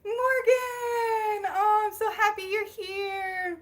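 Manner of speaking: high, loud squeal of welcome, drawn out; overjoyed, loud high-pitched gushing; delighted greeting. Real, not acted. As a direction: cry out in real joy at a friend arriving. Calls out delighted greeting to viewer.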